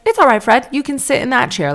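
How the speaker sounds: put-on high, lifted voice coaxing a child; reassuring hygienist. Hygienist letting a nervous boy choose his chair, high and reassuring. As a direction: imitate a hygienist soothing a little boy.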